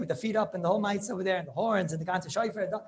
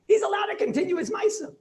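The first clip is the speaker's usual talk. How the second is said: much higher and louder, rising; excited; 10 semitones higher, 6 dB louder than usual. Real, not acted. Rabbi stressing the ruling to students, excited. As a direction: sell the surprise of the ruling.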